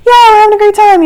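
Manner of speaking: high mock yell, pushed over noise; imitated screaming. Passengers who can't hear answer the pilot, mock screaming. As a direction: call it out high, as if nobody can hear. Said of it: "We're all screaming".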